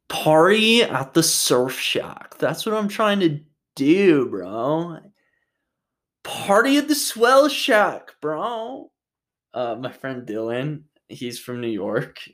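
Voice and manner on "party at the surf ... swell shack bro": slow, high, laid-back surfer-dude drawl; Dylan's surfer bro voice. Retold or imitated. Mocking his friend's new LA talk, hammed-up surfer bro. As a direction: stretch it out like a beach bum bro. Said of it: "now he talks like that".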